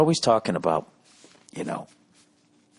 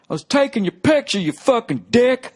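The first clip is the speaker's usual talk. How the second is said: yelling heckler; 5 dB louder than usual. Retold or imitated. Heckler yelling at the comic from the crowd, high and loud. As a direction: bellow it like an offended drunk in the crowd. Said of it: "he just yells out".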